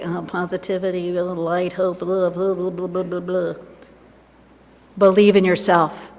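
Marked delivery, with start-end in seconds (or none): 0.0-3.5 softer, bored and scoffing; the world scoffing at hope, mock dismissive and softer. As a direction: brush it off like the world mocking hope. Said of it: "The world's gonna say".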